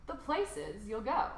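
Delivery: plain reading voice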